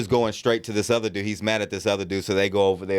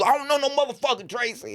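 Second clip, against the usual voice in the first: very high, exasperated imitation; high-pitched retold outburst; mock fury. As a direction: act out a drunk guy losing it. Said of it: "He was getting so mad".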